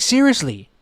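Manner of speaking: normal talking voice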